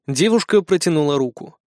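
plain reading voice

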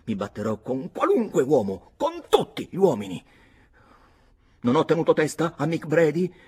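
loud, high, angry bragging shout; furious boasting. Acted. Jealous Redmond shouts his boasts to Nora, loud and high.

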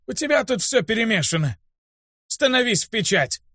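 высокий стариковский голос с раздражением; командуй ученику раздражённо, но негромко; раздражённый старик. A narrator's reading. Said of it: "рявкнул раздражённо". (раздражённый старый учитель)

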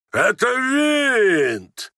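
high, drawn-out enraged shout. Acted. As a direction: yell it high, stretching the last word. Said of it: "кричал генерал". (яростный протяжный крик)

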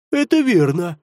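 three heads agreeing in unison; many-headed hydra. Acted. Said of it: "закивали согласно все три головы змея Горыныча".

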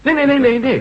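high, loud rapid "no no no"; overexcited denial, pitch jumping up; insistent protest. Acted. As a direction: cut through the laughter, protesting. Protesting over the laughter.

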